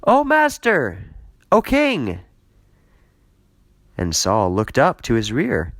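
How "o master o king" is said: high ringing call to the king; yelled call. Acted. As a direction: call out after him, loud and high. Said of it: "And David yelled after Saul".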